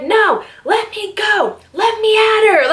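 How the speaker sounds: high, slow pleading cry; mock fired-up plea; itching to fight. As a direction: strain to get at her. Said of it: "And I'm like".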